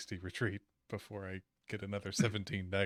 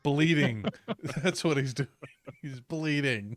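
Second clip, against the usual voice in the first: raised voice, louder and higher; loud joking answer; 4 semitones higher, 8 dB louder, slower than usual. Real, not acted. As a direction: call it out over the laughter. Loud joking answer amid laughter.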